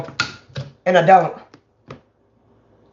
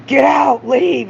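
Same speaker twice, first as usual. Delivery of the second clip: screaming get out; 7 semitones higher, much slower than usual. Retold or imitated. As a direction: scream at him to go. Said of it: "Just screaming".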